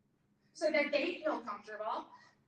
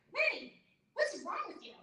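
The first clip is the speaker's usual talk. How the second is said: much higher, mock-exasperated mother; mom scolding; 9 semitones higher than usual. Mom scolding her daughter in the restaurant, much higher and exasperated. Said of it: "She's like".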